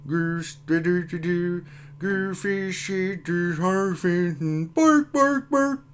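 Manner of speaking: high mock-Swedish gibberish; Swedish chef. Streamer greeting a Swedish viewer, Swedish chef impression. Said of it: "It's a Swedish chef".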